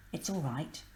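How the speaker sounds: Grandpa soothing softly, half-whispered. Acted. Grandpa comforting Angela in the dark theatre, softly whispered. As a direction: hush it gently to comfort her. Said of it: "he whispered".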